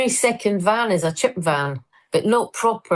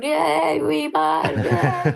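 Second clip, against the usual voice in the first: high cheering crowd; high, excited imitation of fans cheering; 10 semitones higher, much slower than usual. Edinburgh crowd cheering her on.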